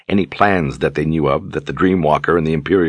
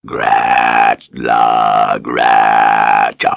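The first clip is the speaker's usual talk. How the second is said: deep, loud beast rumble; gar creature voice; 7 semitones lower, 4 dB louder, much slower than usual. Acted. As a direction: growl it out like a big beast. Beast answers Richard's hug.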